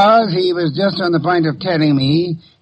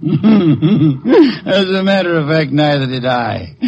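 laughing admission; slower than usual. Acted. Detective tells Archie he bluffed, laughing slowly. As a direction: chuckle through the confession.

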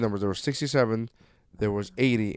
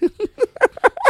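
high laugh; 5 dB louder than usual. Real, not acted. Laughing after retold quote.

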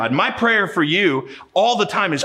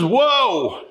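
higher, slower mock alarm; Isaiah overwhelmed before God, higher, slow mock fright; frightened retreat; 5 semitones higher, much slower than usual. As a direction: back away in awe and fear. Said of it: "He says".

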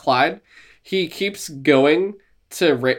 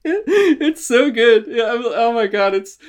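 laughing; 8 semitones higher, slower than usual. Real, not acted. Still laughing at the bit.

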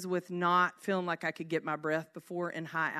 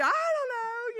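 put-on whiny kid. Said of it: "he's gonna be".

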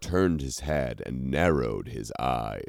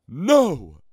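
much higher, louder anguished scream; 12 semitones higher, 7 dB louder than usual. A narrator's reading. The king screaming as the mobs charge. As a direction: scream it in despair. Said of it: "The king screamed".

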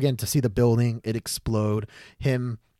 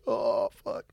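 breathy voiceless groan of misery; imitated dying man's groan; miserable. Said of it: "so miserable".

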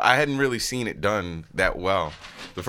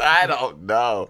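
slow, louder mock wail; mock crying; 2 semitones higher, 5 dB louder, much slower than usual. Imitating the actor cornered about his accent, mock crying. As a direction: draw it out like a hammed-up breakdown. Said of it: "He just cried".

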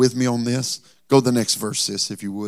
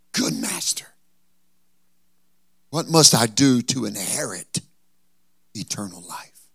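breathless ruler. Acted.

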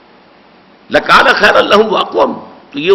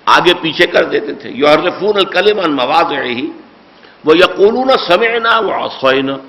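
ordinary delivery

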